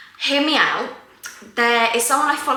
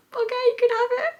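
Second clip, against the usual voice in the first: high sweet voice. Retold or imitated. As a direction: lift the voice high and gentle. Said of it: "I was like".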